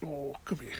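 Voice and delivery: soft whisper to the cat; whispered call to a pet; whispered coaxing. Real, not acted. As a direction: breathe it out like a secret. Coaxing her cat close.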